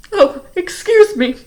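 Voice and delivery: high, strained put-on sob; fake crying. Stefano faking tears over Doctor Montgomery, high and choked. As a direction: fake a breakdown to fool them. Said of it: "pretended to dab at his eyes".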